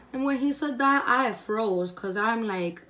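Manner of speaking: ordinary talk, nothing marked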